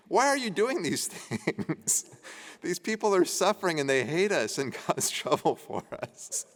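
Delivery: reading Joseph's line through real giggles; can't hold back the laughter mid-sentence; breaking into real laughter while reading; laughing while reading. Real, not acted. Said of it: "Sorry, I know it's not funny, but it is".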